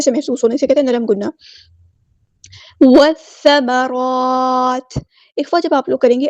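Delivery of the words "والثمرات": slow, drawn-out melodic tajweed recitation; Quran recitation. Shown as a demonstration. Teacher recites the verse for students, slow melodic chant. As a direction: chant each word slowly in tajweed.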